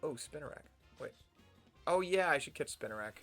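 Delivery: ordinary talk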